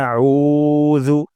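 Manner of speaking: আউযু with the u drawn out two counts; long u in আউযু. Shown as a demonstration. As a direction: stretch the উ in আউযু slowly. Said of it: "এক আলিফ টান দিব".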